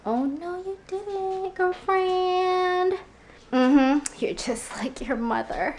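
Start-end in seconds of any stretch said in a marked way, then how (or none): 0.1-2.9 sassy. Retold or imitated. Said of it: "I'm thinking".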